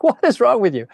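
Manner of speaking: a mock exasperated what's wrong; baffled outburst. He asks his girlfriend why she holds him back, baffled. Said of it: "I'm like".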